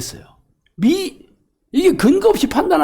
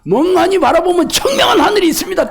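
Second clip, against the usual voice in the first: bold, higher-pitched retort, playing on 멍청; cheeky comeback; 6 semitones higher than usual. New hire firing back cheekily at the chairman in the interview. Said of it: "그래서 내가 그랬어요".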